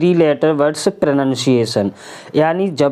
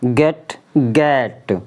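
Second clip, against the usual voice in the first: slow stretched get; 6 semitones lower, much slower than usual. Shown as a demonstration. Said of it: "तो गेट".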